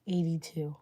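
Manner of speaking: usual speaking voice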